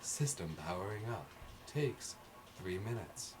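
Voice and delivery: lightly voiced system announcement; soft, level machine announcement; automated voice. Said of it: "automated voice saying".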